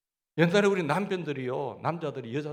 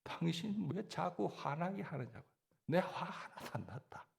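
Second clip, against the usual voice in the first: Gyeongsang couple quarrel; 3 semitones higher, 10 dB quieter than usual. Retold or imitated. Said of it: "액센트가 앞에 있기 때문에".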